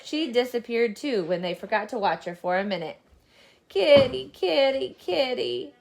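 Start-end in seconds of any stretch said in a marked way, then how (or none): 3.7-5.7 sad calling. Acted. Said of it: "Peter called sadly".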